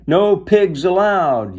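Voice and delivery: loud, drawn-out high shout; slow high yell of a bossy sister. A narrator's reading. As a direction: bellow it to keep the pig out. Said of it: "Eugenia shouted".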